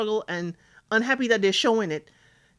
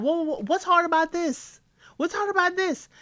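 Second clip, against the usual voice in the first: incredulous mock quote; 10 semitones higher than usual. Mimicking her disbelief at him.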